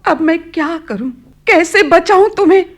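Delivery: high, loud anguished plea; desperate cry. Acted. Mother despairing over her sick daughter, loud anguished cry. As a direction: cry out helplessly to heaven.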